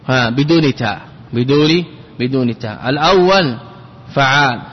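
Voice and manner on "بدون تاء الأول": ordinary talk, nothing marked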